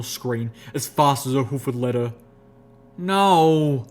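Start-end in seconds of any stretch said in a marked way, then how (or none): 3.0-3.9 higher, louder dismayed moan. A narrator's reading. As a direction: groan it in dismay. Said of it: "she moaned".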